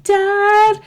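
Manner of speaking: high surprised "Dad?". Retold or imitated. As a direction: blurt it out in a high squeak. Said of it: "he's like".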